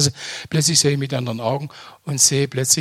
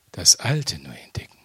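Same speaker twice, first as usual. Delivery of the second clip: soft, lower whisper of the phrase; 11 semitones lower, 6 dB quieter than usual. Shown as a demonstration. Preacher to congregation, savouring his discovery: whispered. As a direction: let the words sink in, breathed out.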